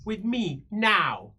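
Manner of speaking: slow, insistent; demanding. Acted. Daughter insisting her father dance now, slow and demanding. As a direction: drag it out like a spoiled demand.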